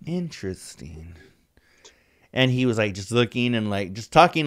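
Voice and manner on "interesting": low, soft, slow Rogan impression; Bert Kreischer's Rogan impression. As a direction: drop low and soft, draw it out. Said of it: "he goes".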